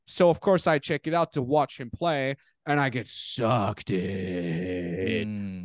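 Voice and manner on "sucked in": low, long stretched-out words; drawn-out words. Real, not acted. Guest telling how he got hooked, drawn-out and low. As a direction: stretch it right out, dropping low.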